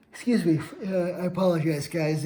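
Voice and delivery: talking through a yawn; words stretched by a yawn. Real, not acted. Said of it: "if I'm yawning".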